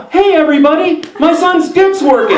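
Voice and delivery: giddy father broadcasting good news; excited dad on the phone. Retold or imitated. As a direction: play the proud dad shouting the news to everyone. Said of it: "my dad over the phone goes".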